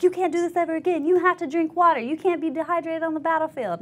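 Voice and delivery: high, loud lecture; mock scolding yell. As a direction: lay into the recruit, fast and high. Hospital staff yelling at recruit.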